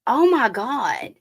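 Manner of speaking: slow, amazed oh my God; surprised. Real, not acted. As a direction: caught off guard by the card. Noticing something in the cards.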